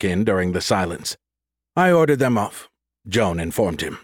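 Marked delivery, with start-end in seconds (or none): 1.7-2.7 higher-pitched firm retort; assertive. Acted. Joan informs Ramus she overrode him, assertive. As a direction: counter him firmly, starting high.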